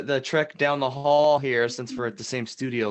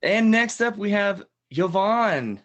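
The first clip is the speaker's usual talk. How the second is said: announcer intro; 4 semitones higher, slower than usual. Acted. Host introducing Yvonne, slow showy announcement. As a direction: lean into the name like a TV host.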